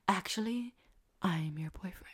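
breathy, low hushed whisper; creepy whisper. Retold or imitated. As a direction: whisper it like a creepy fake lover. AI's creepy fake romantic line.

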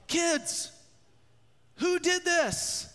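high, slow parental demand; stern parent. Retold or imitated. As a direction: demand an answer from the kids. Parent scolding kids in sermon.

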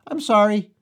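high, chirpy little voice; chirped apology. Retold or imitated. Schoolboy apologizing to his English teacher, high and chirpy. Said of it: "I quickly chirped".

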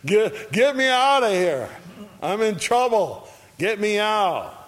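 high, loud desperate cry to be freed; frantic plea. Retold or imitated. Jailer crying out to be rescued, high and loud. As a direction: beg to get out, panicked and high.

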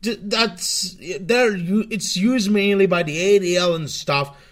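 ordinary talk, nothing marked

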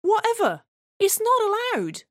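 high, loud squeaky neighbour; mock dwarf voice.

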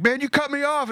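high-pitched mock protest; complaining driver. Acts out road-rage driver.

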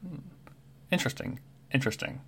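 soft muttering to himself, trailing into a whisper; hushed, curious bird muttering; Kenku mumbling. Acted.

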